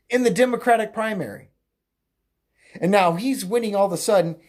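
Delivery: usual speaking voice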